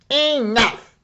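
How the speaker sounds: high, loud fed-up cry; villagers shout. Acted. Villagers shout at the dragon, loud and high. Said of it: "they said".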